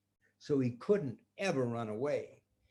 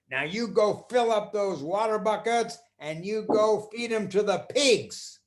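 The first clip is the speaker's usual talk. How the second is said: louder commanding voice; bossy magician; 6 semitones higher, 6 dB louder than usual. Acted. Magician ordering the boy to the pigs, louder bossy voice.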